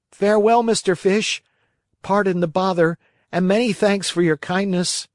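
high, loud, hurried farewell; jerky creaky wooden puppet; scared puppet. A narrator's reading. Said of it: "scared to death".

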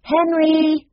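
high, loud summons; calling out. A narrator's reading. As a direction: call your brother from down the hall. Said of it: "called Jessie".

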